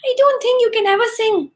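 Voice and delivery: high chirpy bird voice; high cuckoo character. Acted. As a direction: squeak it out like a little bird. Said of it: "Cuckoo said".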